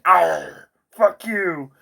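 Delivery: snarling creature. Retold or imitated. The cock dragon biting his hand, loud snarl. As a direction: snap at him like a biting beast.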